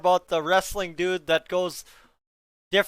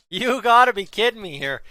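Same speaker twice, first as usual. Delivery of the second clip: louder, stressing gotta; louder disbelief; 3 semitones higher, 7 dB louder than usual. Real, not acted. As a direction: hit gotta harder in disbelief.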